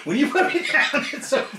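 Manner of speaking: high, worked-up retold warning; mock angry threat. Retold threat to opponent carrying him.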